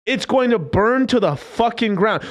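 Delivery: slow, high, hammered-out words; drawn-out forceful prediction; emphatic warning. Real, not acted. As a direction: punch out each word slowly. Repeats the doom warning emphatically.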